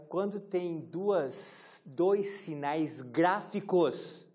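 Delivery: very slow, deliberate dictation; slow teacher emphasis. Shown as a demonstration. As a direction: drag each word out for students copying. (ditado bem lento)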